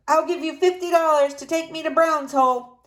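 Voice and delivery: Mister Jones shouting his offer to the sleigh driver. A narrator's reading. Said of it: "Mister Jones shouted".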